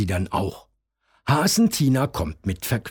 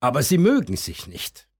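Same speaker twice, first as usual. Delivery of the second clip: ordinary delivery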